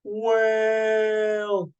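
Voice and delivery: high, long-held cry; stretched-out, high-pitched shout; screamed warning. A narrator's reading. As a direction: call out the word in one drawn-out yell. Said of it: "Jerry looked up and screamed".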